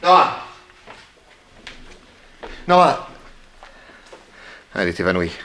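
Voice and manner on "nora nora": calling out. Acted. Calling out to fainted Nora.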